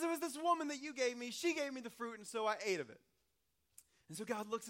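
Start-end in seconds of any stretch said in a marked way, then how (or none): 0.0-3.0 high, loud, defensive excuse; whiny blaming. Retold or imitated. Pastor plays Adam blaming the woman before God, high and loud. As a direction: point the finger, voice up and loud. Adam blames Eve again.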